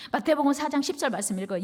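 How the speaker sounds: normal talking voice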